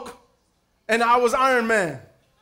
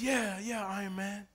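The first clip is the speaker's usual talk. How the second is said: put-on small kid voice; 4 semitones lower, 15 dB quieter than usual. Pastor voices small godson.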